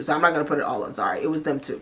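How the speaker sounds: usual speaking voice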